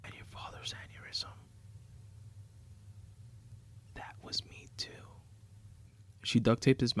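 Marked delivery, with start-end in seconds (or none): 0.0-5.1 whisper. A narrator's reading. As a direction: whisper a cruel confession, voiceless. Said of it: "whispers into his ear".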